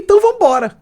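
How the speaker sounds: high, loud, eager go-ahead; retold excitement. Novice singer jumping into the next song, excited. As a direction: launch in with pitch way up. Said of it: "Aí eu me empolguei".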